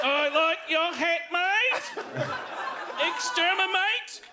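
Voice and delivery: high, slow Dalek crossed with Australian accent. Shown as a demonstration. Showing how talking Australian makes him a Dalek, high Dalek screech. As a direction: screech it like a Dalek with an Aussie twang. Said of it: "I become a Dalek".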